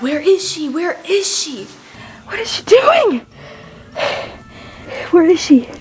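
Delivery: mock anxious asking. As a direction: re-enact anxiously asking after someone, stretching the repeat. Retelling herself panicking in pantry.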